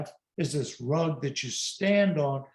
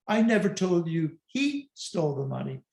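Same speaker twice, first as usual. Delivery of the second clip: long, raised "he", the other words unstressed; stress on HE. Shown as a demonstration. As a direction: hit the word "he" in mid-sentence. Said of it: "emphasize a different word".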